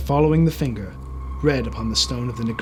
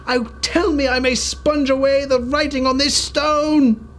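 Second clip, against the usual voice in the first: much higher and louder, pleading promise; fervent vow; 11 semitones higher, 5 dB louder than usual. Acted. Scrooge vowing to the spirit to honor Christmas, fervent. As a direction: swear it with all your heart.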